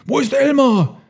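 high elephant call; searching call. A narrator's reading. Herd searches for missing Elmar, high urgent call.

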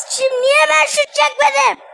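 loud frustrated complaint; indignant shout; exasperated yelling. Real, not acted. Complaining loudly at the game.

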